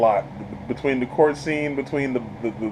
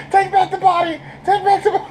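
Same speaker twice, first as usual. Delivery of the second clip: panicked scream. Retold or imitated. Reviewer voicing stabbed Steven begging for a switch, high panicked yell. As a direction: scream it like you just got stabbed.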